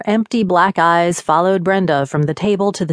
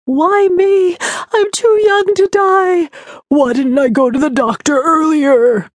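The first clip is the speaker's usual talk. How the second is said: high, wailing, self-pitying ghost; tearful high whine of the dead; whiny ghost; 10 semitones higher, 4 dB louder than usual. A narrator's reading. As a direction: moan it like a sorry-for-herself spirit. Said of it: "They're so whiny".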